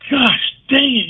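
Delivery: high-pitched imitation of an astonished manager; mock amazed outburst. Manager marvels at the player's hitting, mock amazed outburst. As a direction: burst out in retold amazement.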